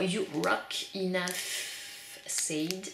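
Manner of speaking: put-on slow, careful French-accented English. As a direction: read it out in a hammed-up French take on English. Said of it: "cet accent anglais absolument merveilleux". (lecture lente avec un accent anglais appuyé à la française)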